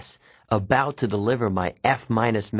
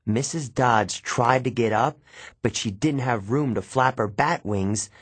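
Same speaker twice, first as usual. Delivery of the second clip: plain reading voice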